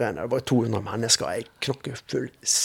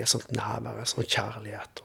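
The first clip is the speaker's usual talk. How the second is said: hushed in awe; 9 dB quieter, slower than usual. Real, not acted. Overwhelmed by presence at the meeting.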